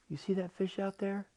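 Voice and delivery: high-pitched octopus asking a question; lightly cartoonish sea-creature voice; talking octopus. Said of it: "the octopus says to him".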